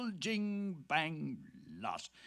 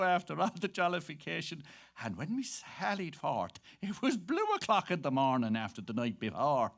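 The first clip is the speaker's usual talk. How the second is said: usual speaking voice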